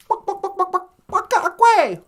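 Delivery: high clucks building to a loud squawk; chicken clucking. Acted. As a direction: cluck rapidly like a riled hen. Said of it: "the chicken".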